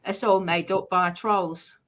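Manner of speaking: usual speaking voice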